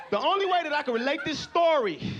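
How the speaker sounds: ordinary talk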